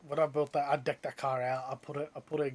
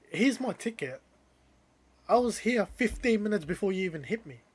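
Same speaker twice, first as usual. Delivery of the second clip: higher and louder, driving the proof home; retold triumphant rebuttal; 5 semitones higher, 5 dB louder than usual. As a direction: win the argument, point by point.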